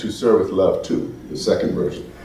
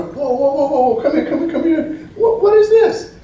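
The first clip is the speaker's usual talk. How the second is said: much higher and laughing, calling someone over in astonishment; incredulous disbelief. Retold or imitated. Shocked American calling his companion over to the stage, laughing incredulous. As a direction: call her over, laughing in disbelief at what you see.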